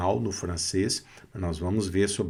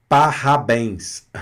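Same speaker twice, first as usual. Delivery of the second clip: parabéns with a throaty French R, slowly; parabéns said the French way, R in the throat; French accent mock; 4 dB louder, slower than usual. Shown as a demonstration. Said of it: "de uma forma afrancesada". (parabéns afrancesado, com R na garganta)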